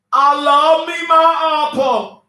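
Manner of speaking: high, slow, drawn-out crooning; mock swooning. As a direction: croon it like a lovesick fool. Drawn-out mock swoon over her man.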